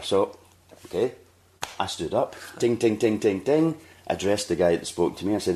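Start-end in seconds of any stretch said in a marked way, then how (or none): 2.6-3.8 glass-tapping ding. Retold or imitated. Standing up in the restaurant, he mimics tapping a glass with rising dings. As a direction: chime it like a spoon on a glass.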